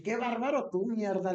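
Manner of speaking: high, loud scolding cry; loud mock scolding at the cousin; retold scolding shout. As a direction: shout him down like a prank. Scolding cousin she thinks joking.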